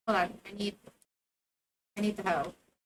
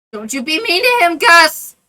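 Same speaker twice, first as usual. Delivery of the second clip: loud, higher scolding of Gus; loud scolding read; 5 semitones higher, 15 dB louder than usual. Acted. Reading character line.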